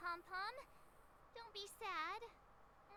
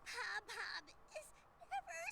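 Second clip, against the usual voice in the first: crying; 7 semitones higher than usual. Acted. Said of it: "you look pretty sad to me".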